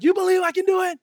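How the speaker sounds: high, loud mock showman shout; rally the crowd with a challenge; showman's call. Tightrope walker challenges the crowd, shouting. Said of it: "He's like".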